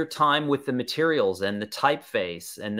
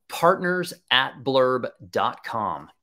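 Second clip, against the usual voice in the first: spelling out the address slowly; slow dictation; much slower than usual. Shown as a demonstration. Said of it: "is the email address that you want to use".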